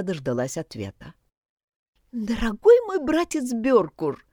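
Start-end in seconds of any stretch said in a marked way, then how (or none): 2.1-4.2 high, slow squeaky sweet-talk. Acted. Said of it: "заговорила она писклявым голосом".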